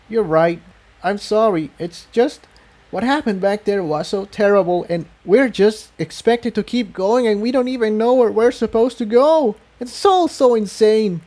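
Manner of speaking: a shaken pony pouring out her fear; lifted voice for the distressed mare; Tiptoe, upset. A narrator's reading.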